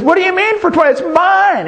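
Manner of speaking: put-on boy protesting to the storekeeper, indignant and high. A narrator's reading. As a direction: fight for what's his, shrill with disbelief.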